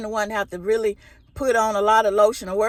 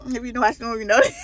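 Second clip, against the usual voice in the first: ordinary talk, nothing marked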